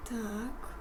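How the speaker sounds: usual speaking voice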